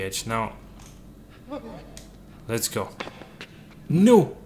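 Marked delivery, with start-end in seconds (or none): 3.9-4.4 sudden loud cry of no; shouting. Real, not acted. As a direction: yell it in shock. Crew falls to death.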